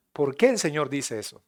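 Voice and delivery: ordinary delivery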